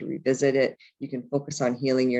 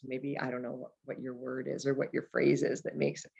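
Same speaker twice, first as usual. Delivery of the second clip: ordinary delivery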